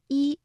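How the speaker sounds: final 一 held high and level; 一 in its original level tone; first tone. Shown as a demonstration. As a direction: sustain 一 on one high note. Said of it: "原来呢是读第一声".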